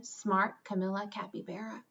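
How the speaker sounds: usual speaking voice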